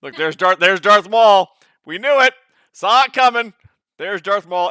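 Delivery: excited shouting. Real, not acted. Player yelling at the table because he called the villain, excited. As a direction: shout it out, the guess came true.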